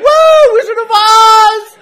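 very high, loud, drawn-out whoop; mock startled reaction. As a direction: squeal in mock amazement.

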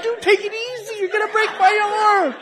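high-pitched comic pleading; mock pained protest. Mock protest to arm-puller.

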